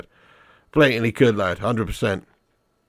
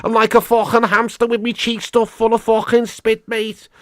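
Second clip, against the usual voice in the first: high, hammed-up Scouse with a mouthful of spit; mock Scouse accent; 8 semitones higher, 5 dB louder than usual. Streamer mocking his viewer's Scouse nickname, exaggerated Scouse accent. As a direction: do a spitty Jamie Carragher-style Scouse parody. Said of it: "I fucking speak like Jamie Carragher".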